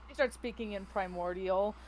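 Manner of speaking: ordinary talk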